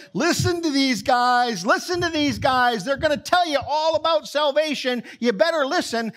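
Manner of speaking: put-on high, loud, nagging fortune-telling girl; pushy fortune teller. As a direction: tag along shouting at the crowd, high and shrill. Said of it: "she goes around following them saying".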